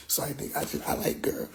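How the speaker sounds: whispered, unvoiced aside; hushed punchline. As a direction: drop it in a whisper. Quiet punchline.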